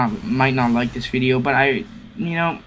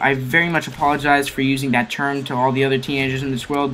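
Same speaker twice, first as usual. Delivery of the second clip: ordinary talk, nothing marked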